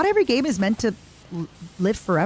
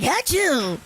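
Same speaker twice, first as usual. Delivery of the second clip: loud sneeze imitation; 8 semitones higher than usual. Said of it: "but instead I'm like".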